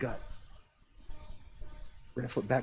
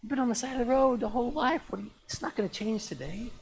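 high, hushed scolding voice; embarrassed crowd; 10 semitones higher than usual. Acted. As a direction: shush a beggar as an embarrassed onlooker. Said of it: "What does the crowd do?".

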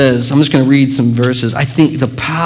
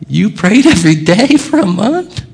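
mock astonishment; 7 semitones higher than usual. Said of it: "I went".

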